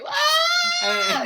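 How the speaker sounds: loud, very high mock scream; imitated scream. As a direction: shriek like the terrified passenger. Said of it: "he's screaming".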